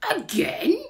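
high, loud rising question; incredulous burglar. A narrator's reading. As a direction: echo it in disbelief. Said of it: "said Burglar Bill".

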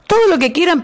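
calling from behind. A narrator's reading. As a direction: call out to the others not to leave. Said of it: "gritó el sapo".

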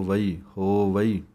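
slow ਵਈ then ਹੋਵਈ; syllabled ਹੋਵਈ. Shown as a demonstration. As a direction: build ਹੋਵਈ up from ਵਈ. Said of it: "ਥੋੜ੍ਹਾ ਜਿਹਾ ਸੰਬੰਧਕ ਕਰਕੇ ਬੋਲੋ". (ਵਈ ਫਿਰ ਹੋਵਈ, ਹੌਲੀ)

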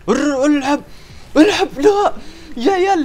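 panicked high squeal of play; frantic pleading. Real, not acted. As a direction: beg the player to go for it. Desperate over missed play.

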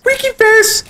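very high squeaky cartoon voice; squeaky winky voice. Shown as a demonstration. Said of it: "I don't know how to get my voice that tone".

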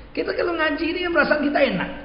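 enthusiastic. Real, not acted. Preacher enthuses about study.

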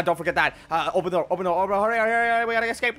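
normal talking voice

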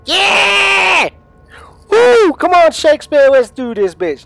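high, very loud whoops of triumph; jubilant shouting. Real, not acted. Gamer telling viewers he found Shakespeare, jubilant shouting. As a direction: yell it out, thrilled at the find. Celebrating finding Shakespeare in game.

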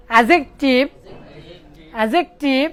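অ্যাডজেক্টিভ as "a-jek-tiv", no d; silent d. Shown as a demonstration. Teacher drilling students on অ্যাডজেক্টিভ with silent d. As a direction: repeat অ্যাডজেক্টিভ with pauses, dropping the d. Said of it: "ডির উচ্চারণটা হবে না".

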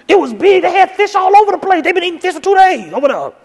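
wife backing the tale, high earnest voice; insisting wife. Retold or imitated. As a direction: swear by the story. Wife insists fish everywhere.

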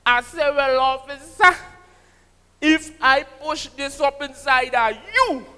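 John Agitation impression. Comedian telling a rude joke to the crowd, loud and sing-song. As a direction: ham up the bawdy tale like a calypsonian. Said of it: "That agitation".

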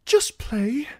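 pleading. A narrator's reading. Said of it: "Sam pleaded".

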